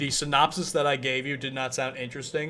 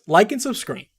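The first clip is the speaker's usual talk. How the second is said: excited; 7 semitones higher, 7 dB louder than usual. Acted. Outro plea to viewers.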